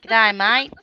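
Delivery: rough attempt at an Australian accent. Shown as a demonstration. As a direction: have a go at an Aussie accent. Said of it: "I love the Australian accent".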